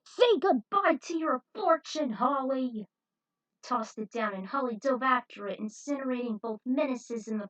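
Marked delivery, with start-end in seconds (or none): 0.0-2.9 higher, louder shout of triumph; raging taunt. Acted. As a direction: hurl it at him in rage. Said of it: "using all his rage".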